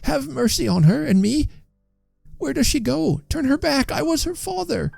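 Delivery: put-on high-pitched old man begging; anguished plea. A narrator's reading. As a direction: implore the spirits in a high, thin voice. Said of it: "cried the old man, stretching out his hands".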